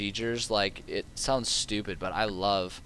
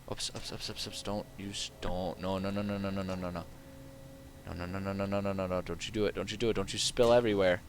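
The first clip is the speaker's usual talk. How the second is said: soft, rapid-fire stream of worried muttering; hushed panic; 7 dB quieter than usual. Real, not acted. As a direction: plead under your breath not to spill. Trying not to spill, hushed panic.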